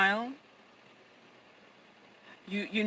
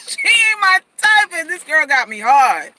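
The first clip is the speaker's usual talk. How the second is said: loud, lightly voiced amazed man; mock baffled man, louder; aroused man; 6 semitones higher, 11 dB louder than usual. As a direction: keep playing the flustered guy. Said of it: "He's gonna go".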